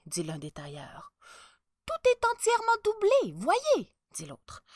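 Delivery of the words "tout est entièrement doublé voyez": very high-pitched, loud sales voice; second swindling tailor. A narrator's reading. Second tailor showing the emperor the lining, eager. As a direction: show off the goods in a high voice.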